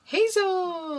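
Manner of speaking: high excited exclaim of the name; excited call. Acted. Co-host cheers the baby's name, high and excited.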